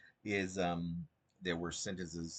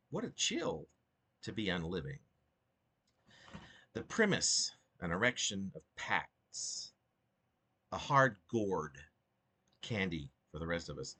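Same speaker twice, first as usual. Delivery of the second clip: measured poetry reading with lifted, sung-out lines; reading his own poem aloud; 2 semitones higher, 4 dB louder than usual. Acted.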